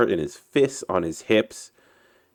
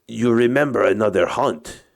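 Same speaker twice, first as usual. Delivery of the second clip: put-on higher-pitched Russian captain's accent; Russian-accented story character, raised pitch; 4 semitones higher than usual. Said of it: "uh, my Russian accent".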